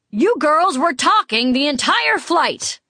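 high, slow, indignant telling-off; scolding. A narrator's reading. Rich passenger to chatty women off the flight, scolding. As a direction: scold them, drawing out each accusation. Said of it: "he was preparing to scold me".

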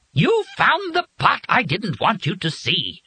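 very high, slow, agitated screech; outraged outburst. A narrator's reading. Bird learns Joe found the pot, outraged. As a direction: cut in with horrified fury, drawn out.

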